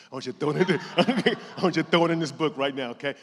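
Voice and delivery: hyped crowd call with a laugh. Real, not acted. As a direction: fire the room up.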